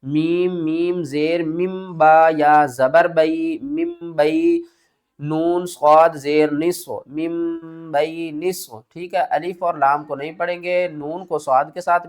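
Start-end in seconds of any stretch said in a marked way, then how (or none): 0.6-8.8 slow, syllable by syllable spelling; slow tajweed spelling drill. Shown as a demonstration. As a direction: sound out each letter slowly. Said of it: "کس طرح؟".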